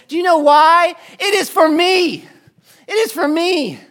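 high, loud, near-shouted preaching; impassioned plea. Acted. Preacher confronting Justice with the tortured Christ, high loud cry.

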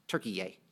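"Turkey yay" with an added yay on the end; airline pronunciation. Retold or imitated. Preacher quoting the airline's name for Turkey, odd pronunciation. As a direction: say "Turkey yay", tacking a drawn "yay" onto Turkey, softly. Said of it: "as they say on, um, Turkish Airlines".

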